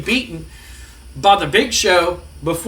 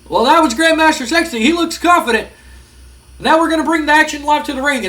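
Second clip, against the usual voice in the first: commentator; 8 semitones higher than usual. Acted. Voicing the ringside commentator.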